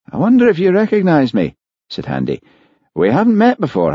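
lightly voiced pompous man; polite and a bit grand; Handy, self-important gentleman. A narrator's reading.

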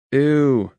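loud high grossed-out ew; disgusted ew. A narrator's reading. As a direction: recoil in disgust. Reacting to vomit.

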